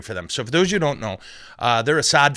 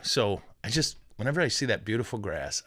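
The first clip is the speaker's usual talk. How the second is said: ordinary delivery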